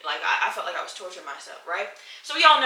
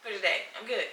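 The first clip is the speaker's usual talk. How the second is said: mock coke fiend; 3 semitones higher, 9 dB louder than usual. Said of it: "coke fiend ... be like".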